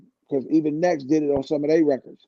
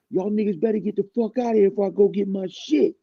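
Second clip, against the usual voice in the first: higher threatening voice; 6 semitones higher than usual. Retold or imitated. Tretch threatening them.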